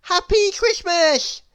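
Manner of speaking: high, loud cheerful nonsense greeting; Mister Nonsense. Acted. Mister Nonsense wishes her a happy Christmas. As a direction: blurt it out merrily. Character voice.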